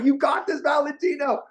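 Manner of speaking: shouted self-encouragement; hyping himself up. Retold or imitated. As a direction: pump yourself up in the mirror. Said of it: "hyping yourself up".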